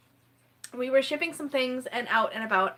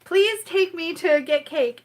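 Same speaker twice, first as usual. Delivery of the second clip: high begging, slowing down; pleading for cake; 5 semitones higher, 4 dB louder, slower than usual. Retold or imitated. Begging Peggy to drive for cake, mock pleading. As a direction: beg playfully to keep driving. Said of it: "And I was like".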